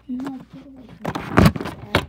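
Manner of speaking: panicked shout; screaming at a spider. Real, not acted. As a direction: yell it in a sudden fright. Screaming at a spider near iPad.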